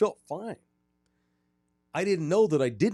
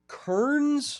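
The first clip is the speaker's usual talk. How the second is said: high questioning echo; incredulous; 14 semitones higher, 4 dB louder than usual. Real, not acted. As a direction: repeat it in disbelief. Echoing odd town name.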